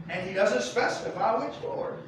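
usual speaking voice

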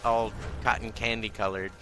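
normal talking voice

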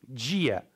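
Dia said "djia"; Brazilian pronunciation. Shown as a demonstration. Teacher showing D softened, Dia as "djia". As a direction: begin Dia with a j sound. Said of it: "like the D becomes".

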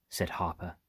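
plain reading voice

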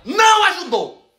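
high, drawn-out indignant outburst; indignant denial. Real, not acted. Vlogger rebutting that anyone helped them, indignantly. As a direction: burst out with a stretched, high-pitched rebuttal.

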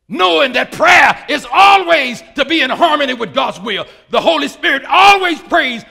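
loud, high, driving sermon delivery; rousing pulpit shout, easing slightly toward the end; full-force preaching. Acted. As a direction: preach it hard, shouting the key phrases.

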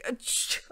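dragon sneeze cutting speech off; acted sneeze. A narrator's reading. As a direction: sneeze suddenly. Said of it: "the dragon's sneeze".

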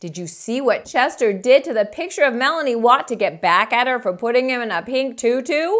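usual speaking voice

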